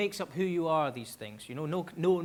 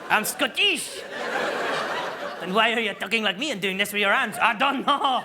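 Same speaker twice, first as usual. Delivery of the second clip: loud, indignant mock-French outburst; exasperated French-accented quarrel; 5 semitones higher, 5 dB louder than usual. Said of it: "with a slight French accent".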